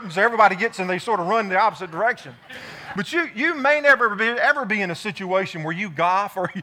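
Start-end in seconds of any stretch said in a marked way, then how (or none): none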